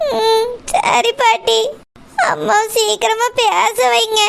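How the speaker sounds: slow, sniffling agreement; weepy. Acted. Granddaughter agrees with her grandmother through tears, sniffling.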